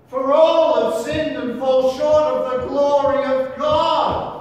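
intoned scripture, loud and high; preaching at full force. Real, not acted. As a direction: proclaim it like a hymn line.

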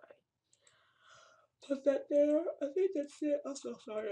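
words stretched high through a big yawn. Real, not acted. Tired blogger yawning mid-tutorial, high and stretched. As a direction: talk right through the yawn. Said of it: "I'm yawning".

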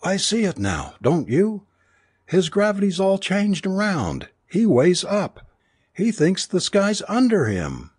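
high, urgent woman's voice; mild scream, sustained high and excited; screamed realization. A narrator's reading. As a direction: cry it out in frantic discovery, pitched far up. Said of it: "she screamed".

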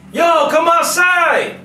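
high, drawn-out call to a friend. Retold or imitated. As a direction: call up to the window, long and slow. Kids calling friends outside.

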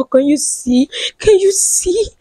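usual speaking voice

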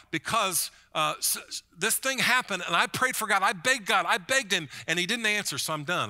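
hammed-up aggrieved churchgoer, pitch climbing; upset complaint. Pastor voicing a hurt churchgoer to the congregation, mock aggrieved.

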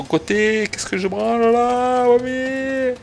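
very high, drawn-out whine; exasperated wail. Real, not acted. Player lost on the wrong side, exasperated high wail. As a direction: climb into a long high wail of frustration.

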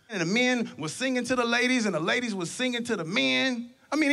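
normal talking voice

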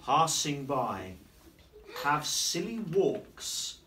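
slow, drawn-out rhyme reading; reading to children. A narrator's reading. Teacher reading rhymes to young pupils, slow and playful. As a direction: linger on each silly line. Reading rhyming picture book aloud.

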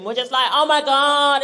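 usual speaking voice

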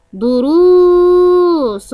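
long held oo after the r in دروس; دروس said slowly with a sustained roo; elongated letter. Shown as a demonstration. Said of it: "الحرف الممدود حرف الراء ممدود بالواو".

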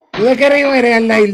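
loud shout asking a teammate where he is from; calling out. Real, not acted. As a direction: call the question out across the chat. Asking teammate over voice chat.

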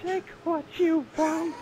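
high, loud, laughing coy offer; high flirty voice. Pretend. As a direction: offer yourself with a giggle.

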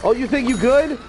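teasing jeer at a beaten player; mocking taunt. Real, not acted. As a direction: rub it in his face. Mocking opponent in game.

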